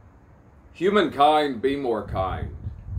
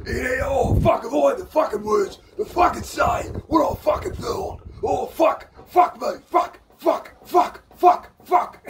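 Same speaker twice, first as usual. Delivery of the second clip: excited shouting; 10 semitones higher than usual. Real, not acted. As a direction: yell the curses in pure delight, going higher. Ecstatic rant about the performance.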